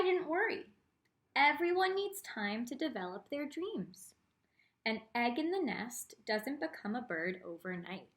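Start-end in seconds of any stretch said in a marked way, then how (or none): none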